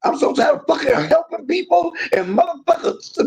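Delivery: fed up with people who betrayed her; cussing in anger. Real, not acted. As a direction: let the frustration burst out. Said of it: "I'm cussing now".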